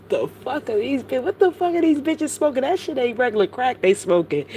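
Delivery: high-pitched disbelief; incredulous outburst. Real, not acted. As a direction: pitch shoots up, baffled and exasperated. Incredulous outburst about their bizarre behaviour.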